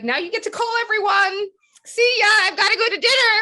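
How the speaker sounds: high, loud mock sing-out; breezy loud sign-off. Agent signing off after big news, loud and breezy. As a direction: call it out like an excited send-off.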